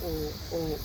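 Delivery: ordinary talk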